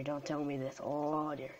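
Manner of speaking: normal talking voice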